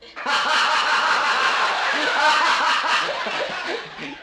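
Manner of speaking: long, high, loud cackle; helpless laughing fit. Real, not acted. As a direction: crack up in high-pitched bursts. Cracks up at the volunteer's name.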